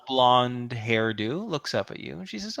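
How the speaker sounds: usual speaking voice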